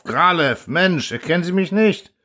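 high, desperate appeal; horrified cry. A narrator's reading. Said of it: "schrie Gab entsetzt auf".

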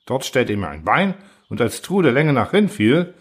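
plain reading voice

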